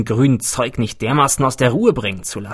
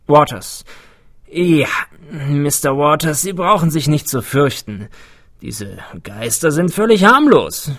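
higher, animated character voice; lively older host playing down the danger; eccentric older scientist, reassuring; 4 semitones higher, 5 dB louder than usual. A narrator's reading. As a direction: welcome a nervous visitor in, breezily.

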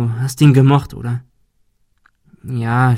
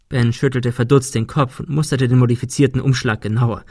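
ordinary delivery, nothing marked

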